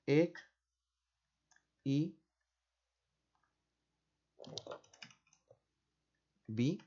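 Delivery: "Ec-, i-, bi-" as isolated syllables with long pauses; teacher slowly spelling out syllables "Ec-, i-, bi-". Shown as a demonstration. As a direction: break off each syllable: "Ec-", "i-", "bi-". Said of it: "silábicamente".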